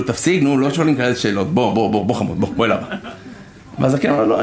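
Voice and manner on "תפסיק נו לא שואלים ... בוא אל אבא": put-on a low, soothing hush to a child; a father calling his curious son back to him, low and soothing. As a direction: coax the kid back gently.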